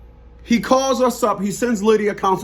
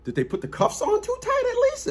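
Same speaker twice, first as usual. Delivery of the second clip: 1 mock incredulous question; 5 dB quieter than usual. Mocking question, much higher.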